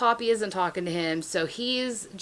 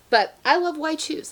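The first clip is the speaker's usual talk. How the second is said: higher, gushing insistence on "I love Why Choose"; gushing emphasis; 7 semitones higher than usual. Shown as a demonstration. Confessing her love of Why Choose books, gushing. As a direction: blurt it out like a confession you're proud of. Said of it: "I'm a huge Why Choose lover".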